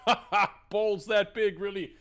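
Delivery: high, loud, incredulous laughing jeer; mocking laugh. Marcus turns to a rival, loud mocking laugh. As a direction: scoff loudly through a laugh. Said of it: "glance turns over and just goes".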